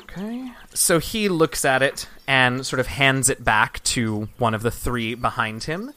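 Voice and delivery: usual speaking voice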